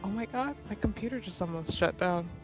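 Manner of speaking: usual speaking voice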